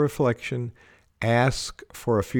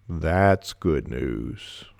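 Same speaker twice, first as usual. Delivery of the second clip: much lower and very slow, rising on "That's"; very low and very slow with a questioning rise; low, doubtful inflection; 8 semitones lower, much slower than usual. Shown as a demonstration. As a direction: drag "That's good news." out low and unsure. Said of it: "We can change the meaning with our inflection".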